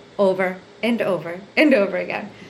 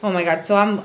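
ordinary talk

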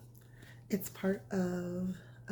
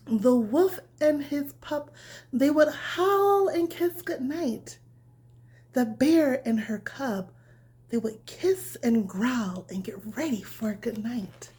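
bedtime story reading; 4 semitones higher, 8 dB louder, slower than usual. A narrator's reading. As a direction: read it gently like tucking a child in. Reads bedtime story to children.

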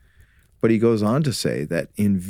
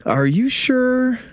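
teasing; 3 dB louder, slower than usual. Acted. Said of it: "You're impossible, husband".

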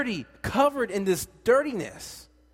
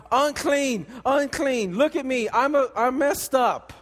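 raised, higher mock cry of unclean; leper calling out; 5 semitones higher, 4 dB louder than usual. Preacher voicing a leper warning the townsfolk, raised and high. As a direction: call out your shame to the town. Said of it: "they have to scream out".

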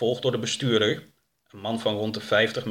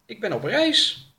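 high, hesitant character voice; pitched-up, uncertain reply; questioned traveller; 11 semitones higher than usual. Acted. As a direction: answer the interrogator in a raised voice. Traveller answers an interrogation.

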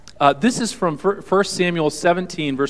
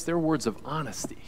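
whispered, softer aside; hushed whisper; 9 dB quieter than usual. Real, not acted. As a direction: breathe the words intimately. Preacher drops to hushed aside.

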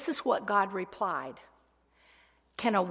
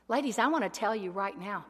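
ordinary talk

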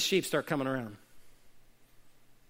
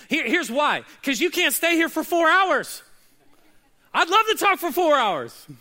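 louder and higher, building fervour; preaching at full force; 9 semitones higher, 6 dB louder than usual. Real, not acted. Pastor answering a congregant, fired up. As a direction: preach it out hard.

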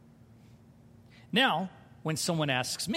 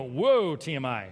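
mock recoil, drops low on "T M I"; recoiling whoa; 8 semitones lower than usual. Pastor acting out a listener's recoil, mock shocked. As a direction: back away from the overshare. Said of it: "you go".

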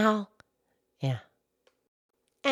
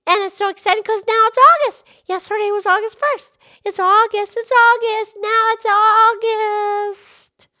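much higher, louder and fast with glee; excited; 9 semitones higher, 4 dB louder than usual. Acted. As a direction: bubble over that it's finally August. Pinky thrilled it's August.

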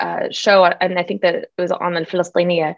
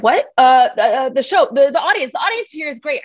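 higher, flustered backpedal; flustered correction; 7 semitones higher than usual. Retold or imitated. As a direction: scramble to fix the mistake. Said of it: "I said".

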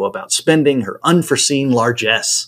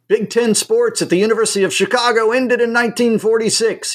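put-on higher, fond voice of an old professor; 10 semitones higher than usual. A narrator's reading. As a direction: let an old teacher reminisce with pride. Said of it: "he fondly observes".

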